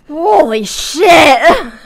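loud, high, hugely drawn-out; hammed-up exclamation. Reader replaying the book's line for laughs, hammed-up. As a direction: stretch both words out in mock awe.

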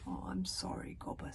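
soft, subdued condolence; a touch of quiet sorrow; solemn. A narrator's reading. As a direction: offer sympathy gravely and softly. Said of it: "said Stoic the Vast solemnly".

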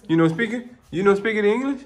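high, loud playful chef act; hammed-up pizzeria host; silly chef voice. Dad continues playful character voice.

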